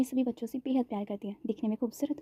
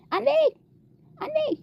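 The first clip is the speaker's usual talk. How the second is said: calling out high and loud across the house; angry call; 4 dB louder, slower than usual. A narrator's reading. Mother calling Anvi from her room, angry shout. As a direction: yell her daughter's name angrily. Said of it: "आवाज़ लगाती है गुस्से में".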